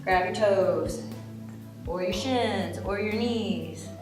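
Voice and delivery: ordinary delivery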